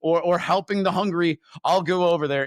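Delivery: excited bargain. Retold or imitated. Telling God he'll go if a verse fits, giddy. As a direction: pitch the deal with a grin. Laughing, new deal with God.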